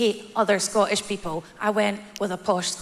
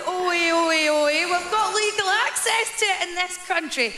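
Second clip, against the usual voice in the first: smug mocking heckler; 10 semitones higher than usual. Mocking someone who boasts about abortion access, in a high jeering voice. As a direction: ham up a gloating know-it-all, pitched up. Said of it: "Don't be going".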